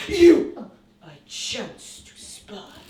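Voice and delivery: slow and loud, every word weighed; pompous poet reading his verse aloud; declaimed verse. Shown as a demonstration. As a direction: proclaim the line grandly. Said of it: "reciting his verse".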